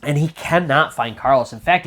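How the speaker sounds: ordinary delivery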